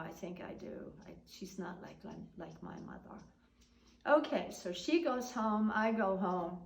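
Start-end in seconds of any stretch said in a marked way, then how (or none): 0.0-3.2 softer, almost whispered; quiet reassurance to a friend; soft aside. Retold or imitated. Quietly admitting her mother's kindness.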